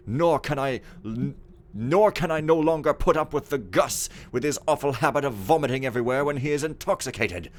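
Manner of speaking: aggrieved steward venting about servants, lively swings in pitch; haughty, scornful steward. Acted. Steward complaining in his journal about servants, haughty and indignant. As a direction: rant like a pompous, disgusted head servant.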